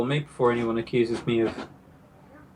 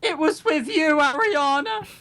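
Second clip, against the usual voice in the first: high pitched swooning declaration; gushing lovestruck student; 12 semitones higher, 3 dB louder than usual. Acted. A student confessing love to Ariana, swooning. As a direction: blurt out the crush.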